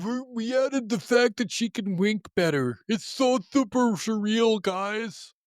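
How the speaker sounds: high mocking developer voice, slowed on the hype. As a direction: parody a studio gushing over a tiny change. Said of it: "mocking remake developers".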